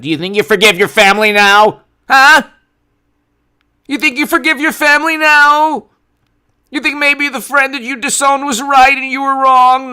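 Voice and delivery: loud, high-pitched taunting yell; hammed-up indignant shouting; mock outrage. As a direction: badger them at full volume, pitch soaring. Acted confrontation of forgiving people.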